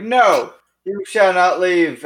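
loud, slow heroic yell; dramatic shout. Acted. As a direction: bellow it like an epic hero. Character shouts at fleeing enemy.

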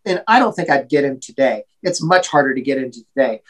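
usual speaking voice

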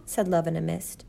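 ordinary delivery

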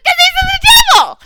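high, loud hammed-up yell; squealed mock outburst; mock shout. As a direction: yell it like an outraged devil. Retelling Lucifer's outraged reply.

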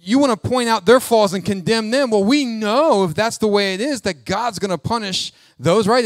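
loud, mock-knowing challenge; condescending. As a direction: throw their judgment back at them. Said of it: "it's almost like condescending".

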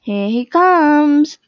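slow, loud excited announcement; can't sit still, he's coming. A narrator's reading. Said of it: "said Saru with an excited wriggle".

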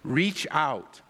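usual speaking voice

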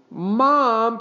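put-on high, drawn-out boy's protest. A narrator's reading. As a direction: whine an objection to Mom. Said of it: "protested Henry".

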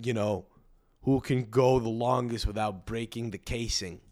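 usual speaking voice

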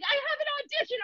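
high whiny excuse; mock whiny roommate. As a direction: pitch up high and whine. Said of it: "He's like".

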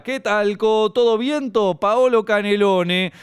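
a corny uncle at the door; the joker arriving at your house, high and slow; cheesy old joker. Retold or imitated. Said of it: "Arriba de todos estos está".

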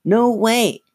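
high puppet echo; Slappy. A narrator's reading. Dummy echoes Lindsay's refusal, high puppet voice. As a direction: repeat it as a squeaky dummy. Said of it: "she made Slappy say".

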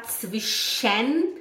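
zwischen with a clearly voiced E, "tsvi-SHEN"; wrong, full E. Shown as a demonstration. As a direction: stretch the last syllable of zwischen into a full "shen". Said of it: "A gente nem fala".

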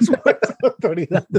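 put-on laughing echo in a Spanish accent; mock Spanish accent. Laughing at a friend's guess, Spanish-accented echo. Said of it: "You would say".

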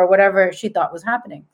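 usual speaking voice

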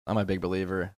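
talking around something in the mouth; words blocked by food in the mouth; mouth full. Real, not acted. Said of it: "Sorry, I got something in my mouth here".

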